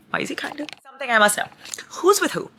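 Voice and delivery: high, squealing curiosity; excited. Real, not acted. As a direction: pitch way up, eager to know. Excited speculation, pitch jumps.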